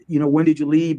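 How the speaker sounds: usual speaking voice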